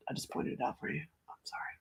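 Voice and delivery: whispered quick apology; unvoiced whispered sorry. Real, not acted. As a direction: breathe an apology without voice.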